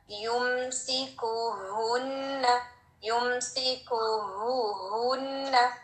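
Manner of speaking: युमसिकुहुन्ना slow with a full, airy हु; strong hu. Shown as a demonstration. Teacher recites slowly with a strong हु. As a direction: give the हु a full round breathy sound. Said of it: "sound भी हु".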